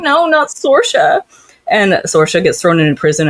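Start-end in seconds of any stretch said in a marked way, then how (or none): 0.0-1.2 high, drawn-out mock plea; hammed-up high protest; mock anguished heroine. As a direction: wail it high and slow, obviously put on. Voices heroine's mock plea for Sorsha.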